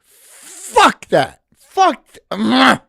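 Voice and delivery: replaying his anger at the result; indignant outburst. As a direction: spit it out in outrage. Outraged reaction recalling the fight.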